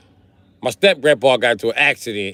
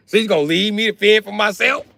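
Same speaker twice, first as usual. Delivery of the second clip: high, loud indignant question; can you believe she left me; outraged complaint; 10 semitones higher, 3 dB louder than usual. Retold or imitated. Grandson outraged at auntie saving brother, high pitched.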